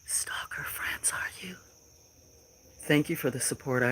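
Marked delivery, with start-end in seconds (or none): 0.0-1.5 hushed whisper to the chat; creepy playful whisper. Acted. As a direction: breathe the words, no voice. Greeting trolls on her stream.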